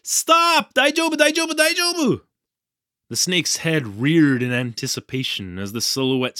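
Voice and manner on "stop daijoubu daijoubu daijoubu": very high, frantic repeated plea; panicked gasp. A narrator's reading. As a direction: ward someone off in a high panic. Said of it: "I gasped".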